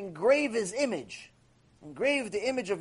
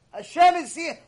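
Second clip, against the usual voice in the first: "Hashem is here" said high and much louder; loud exclaim; 8 semitones higher, 6 dB louder than usual. Shown as a demonstration. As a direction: proclaim "Hashem is here" with force.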